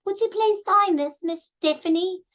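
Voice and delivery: put-on high, polite child's voice with an accent, rising at the end; little kid. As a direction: imitate a sweet little child asking politely. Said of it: "and the little kids".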